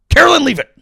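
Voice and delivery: loud, high dog command; yelling at a dog. Acted. Comic scolding press secretary like a dog, yelling. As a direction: shout it as one sharp order to a dog. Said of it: "you're just yelling at a dog".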